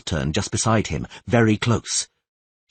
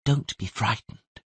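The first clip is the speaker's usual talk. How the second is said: soft, much quieter, soothing murmur; calm a dying man gently; gentle soothing voice; 3 semitones higher, 5 dB quieter, slower than usual. Acted. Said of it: "said the gentle voice".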